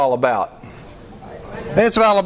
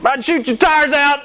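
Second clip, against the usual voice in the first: road rage; 13 semitones higher than usual. Retold or imitated. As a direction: lose it behind the wheel. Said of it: "outburst of wrath ... sports or driving".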